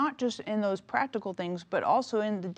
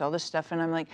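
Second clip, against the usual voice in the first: ordinary talk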